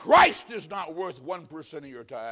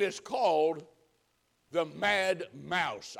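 slow ominous reveal; much slower than usual. Retold or imitated. Buddy reveals the ride's name.

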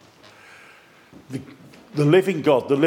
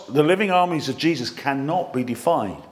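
ordinary delivery

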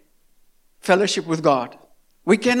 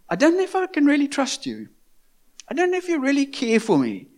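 quick, higher, hesitant mistrust; doubtful ungrateful voice; 6 semitones higher, faster than usual. Retold or imitated. Drowning man telling his rescuer he doubts him, higher. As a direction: sound like you doubt your rescuer.

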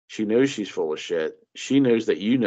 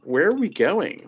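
much higher, mock bewilderment; incredulous; 9 semitones higher, 3 dB louder than usual. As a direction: ask it baffled. Mocking incredulous question.

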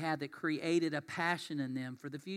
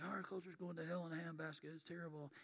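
mock doom lament; 12 dB quieter than usual. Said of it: "It's like".

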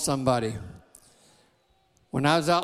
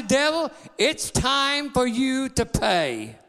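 slow, deliberate warning to the devil; a preacher voicing his own slow challenge to the devil; slow declaration; 7 semitones higher, much slower than usual. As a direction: lay out each word as a verdict. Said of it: "I say".

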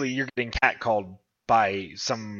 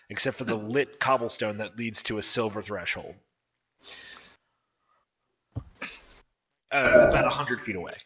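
game master narration. Acted. GM describes the dream scene.

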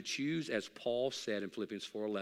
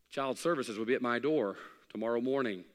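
ordinary talk

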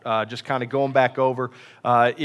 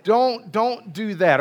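ordinary talk

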